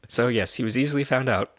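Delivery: ordinary talk, nothing marked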